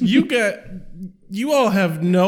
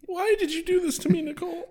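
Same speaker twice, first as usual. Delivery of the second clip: mock despair; 12 semitones higher, 3 dB quieter, faster than usual.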